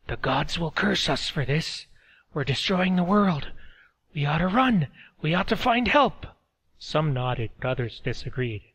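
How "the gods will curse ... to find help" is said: rising, urgent plea to flee; higher and louder, pleading; frightened villager. A narrator's reading. Man urging villagers to flee.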